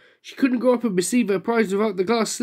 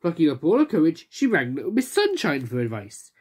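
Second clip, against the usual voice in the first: usual speaking voice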